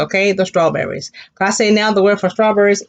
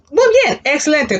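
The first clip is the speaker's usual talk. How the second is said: excited praise; 8 semitones higher than usual. Real, not acted. Praising class answer.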